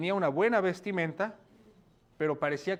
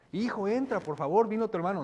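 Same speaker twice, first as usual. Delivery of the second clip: higher, earnest begging; pleading father; 5 semitones higher, 3 dB louder than usual. Acted. Father urges his son to greet his brother, begging. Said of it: "Eso es rogar".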